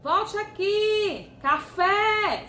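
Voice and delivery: loud call, stressed last syllable held; calling someone. Shown as a demonstration. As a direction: call out Café like a person's name. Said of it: "é o nome de uma pessoa e chamá-la".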